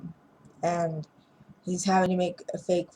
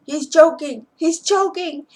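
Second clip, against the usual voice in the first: louder, higher cry of alarm; panicked shout; 10 semitones higher, 7 dB louder than usual. Retold or imitated. Ex-wife crying out as the boyfriend chokes, loud alarmed yell. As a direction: yell it for help across the room.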